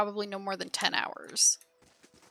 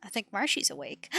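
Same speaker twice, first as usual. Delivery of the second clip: usual speaking voice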